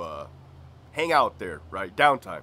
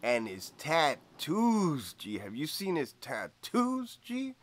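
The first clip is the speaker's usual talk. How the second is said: higher, slow swooning coworker; Greñas voice; 3 semitones higher, much slower than usual. Retold or imitated. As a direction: linger dreamily on the tattoos. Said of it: "He goes".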